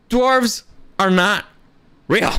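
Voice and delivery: slow, every word weighted; slow emphatic stress. Real, not acted. Insisting to viewers that dwarves are fictional, slow and emphatic. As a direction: lay each word down slowly, hard. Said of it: "I can't say that enough".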